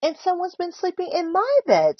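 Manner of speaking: high storybook squeal; indignant squeal. A narrator's reading. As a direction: squeal it like an outraged mum. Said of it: "squealed Missus Chan".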